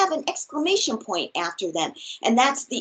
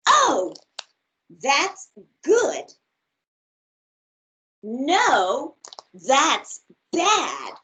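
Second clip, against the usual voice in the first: lively, expressive storybook read; reading to children; slower than usual. Acted. Teacher reads a picture book aloud to her class, bright and expressive. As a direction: read it big and playful for little listeners.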